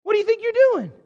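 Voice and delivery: high, loud slap-awake shout; cartoon wake-up yell. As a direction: yell like a Bugs Bunny cartoon. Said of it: "slap him across the face a few times and say".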